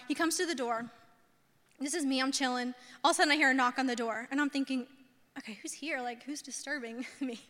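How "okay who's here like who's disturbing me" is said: much softer, under-the-breath thought; quiet private wondering. Retold or imitated. Woman hearing a knock on the door, quietly wondering. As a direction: wonder to yourself, mildly put out.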